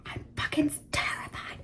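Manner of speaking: sudden hushed whisper of real dread. Real, not acted. To viewers, dreading turning eighteen: a sudden whisper. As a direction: breathe it out like a secret fear.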